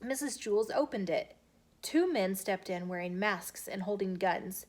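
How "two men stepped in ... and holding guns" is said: usual speaking voice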